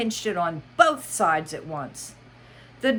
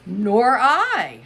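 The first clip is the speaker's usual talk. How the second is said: March Hare voice; 6 semitones higher, 9 dB louder than usual. Acted. March Hare's line.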